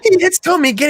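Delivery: loud, high, laughing retelling; amused excitement. Real, not acted. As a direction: blurt it out through laughter. Laughing while retelling the scene.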